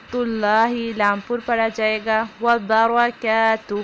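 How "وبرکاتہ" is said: وبرکاتہ slow with heavy ra; correct recitation. Shown as a demonstration. Teacher demonstrating وبرکاتہ=49. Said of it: "روا کو پر پڑھیں گے".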